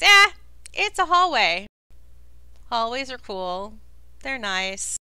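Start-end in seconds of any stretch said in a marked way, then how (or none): 0.0-1.7 high, loud "eh", unimpressed; dismissive shrug. Acted. Game master voicing the party's thoughts, offhand shrug. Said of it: "everybody just thinks".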